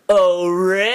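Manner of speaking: loud, high, drawn-out cartoon cry; high cartoon voice, stretched; Stimpy. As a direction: call out like a dopey cartoon cat. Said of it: "then I can do Stimpy".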